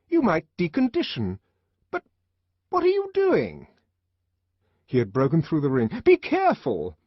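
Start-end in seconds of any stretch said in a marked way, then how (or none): 0.1-3.7 high, sharp and indignant; a nurse losing her composure; head nurse protesting as he breaks through; alarmed nurse. A narrator's reading. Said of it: "head nurse alarmed". 6.0-7.0 put-on high, loud child's cry; a little one shrieking a warning; a child yelling at the savage. A narrator's reading. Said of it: "A child was yelling".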